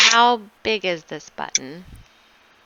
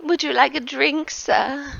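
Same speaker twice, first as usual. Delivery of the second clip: servant voice; 6 semitones higher, 3 dB quieter than usual. Acted. Servant Anton offers drink.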